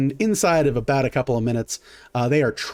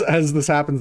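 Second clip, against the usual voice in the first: ordinary talk, nothing marked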